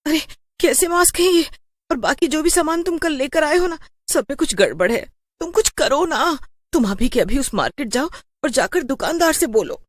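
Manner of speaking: high, pleading worried voice; frightened wife. Acted. Wife begging her husband to go back to the market, high and frightened. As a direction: push him to go now, scared. Wife repeats urgent plea.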